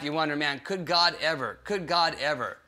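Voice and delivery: usual speaking voice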